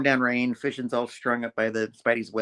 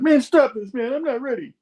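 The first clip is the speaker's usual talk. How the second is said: much higher, sulky protest; whiny villain; 13 semitones higher, 4 dB louder than usual. Retold or imitated. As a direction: whine like a hurt villain. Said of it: "and Fission's like".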